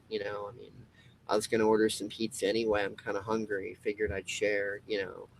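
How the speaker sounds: low, soft, gentle reassurance; calm and soothing. Retold or imitated. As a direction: talk the upset friend down softly. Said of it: "you're calm".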